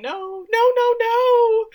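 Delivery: high, loud wailing screams of no, acted out; drawn-out hammed-up scream. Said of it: "Millie starts screaming".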